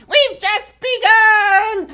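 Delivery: high, slow, tiny-voiced line; little dogs' voice. Little dogs in the song announcing they've just begun, high and slow. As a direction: sing it like a little puppy. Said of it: "Say it with me".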